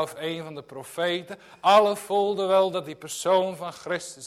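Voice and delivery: loud, fervent pulpit preaching; preaching at full force. Acted. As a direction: drive it home like a revival preacher. Preaching to the congregation. (vurig en luid preken)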